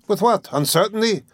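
high, lilting teasing questions; teasing interruption. A narrator's reading. Examining lawyer interrupting the suspect, high and teasing. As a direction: cut in with high-pitched mock innocence. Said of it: "interrupted Porfiry".

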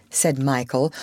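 ordinary delivery

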